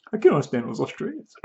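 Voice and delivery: a half-hearted imitation of someone lost by the accent; mocking the preacher. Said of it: "Australian accent".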